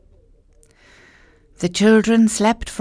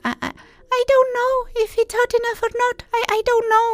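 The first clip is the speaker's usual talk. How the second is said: high, fast, frightened stammer; whimpering; much faster than usual. Acted. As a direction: stammer in terror like a small girl.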